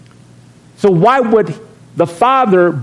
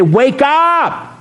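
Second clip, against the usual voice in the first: higher, urgent plea to the church; preaching wake-up call; 7 semitones higher than usual. Retold or imitated. Pastor calling out to all Christians, raised and urgent. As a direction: shake them awake with your voice. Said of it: "to all these Christians and say".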